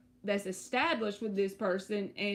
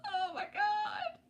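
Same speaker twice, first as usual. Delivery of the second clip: very high, slow oh my God, still half laughing; shocked squeal; slower than usual. Real, not acted. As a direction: squeal it out in disbelief after the laugh. Laughing, reacting to her scary story.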